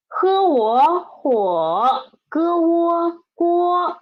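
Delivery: stretched spelling-out of 火 and 锅, sound by sound; syllables sounded out. Shown as a demonstration. Teacher breaking down hot pot for a learner, slow sounding-out. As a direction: slowly blend each sound into the syllable.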